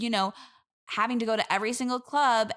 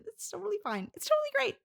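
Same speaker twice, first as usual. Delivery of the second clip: much higher, strained brightness on totally; fake-happy denial, pitched way up; forced cheer; 10 semitones higher, faster than usual. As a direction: insist it's fine through clenched cheer. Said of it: "that sounded like you're a little maybe jealous".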